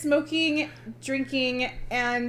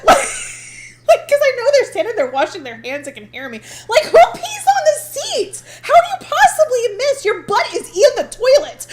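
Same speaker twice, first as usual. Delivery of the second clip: high, loud, laughing through the words; laughing rant; 10 semitones higher, 7 dB louder than usual. Real, not acted. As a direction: rant while cracking up. Laughing rant about pee seat.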